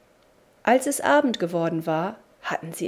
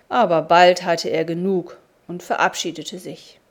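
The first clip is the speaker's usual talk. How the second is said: ordinary delivery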